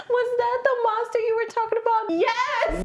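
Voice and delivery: very high excited questions; hammed-up excited wife. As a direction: squeal it like a scared wife. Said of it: "the wife is like".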